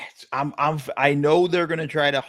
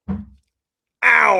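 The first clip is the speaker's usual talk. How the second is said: sudden sharp yelp of pain, high and loud; stubbed toe; 9 semitones higher, 8 dB louder than usual. Real, not acted. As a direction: cry out as the toe hits. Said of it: "I just stubbed my toe".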